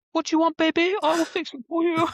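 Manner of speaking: put-on very high, loud cooing to a baby; hammed-up squeaky baby talk, mocking a doting parent. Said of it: "when people do the baby voice".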